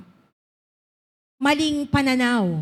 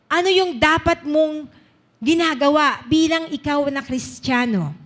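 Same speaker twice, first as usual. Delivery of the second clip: raised, emphatic sermon voice; preaching; 4 dB louder than usual. Acted. Preacher urging the congregation to follow the Bible, emphatic preaching.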